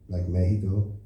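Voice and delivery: "Mexico" said "Mehico" with an H; Mexico as Meh-hee-co; Spanish X as H. Shown as a demonstration. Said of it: "the X makes an H sound".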